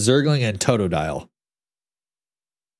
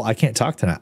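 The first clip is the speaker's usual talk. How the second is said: ordinary delivery, nothing marked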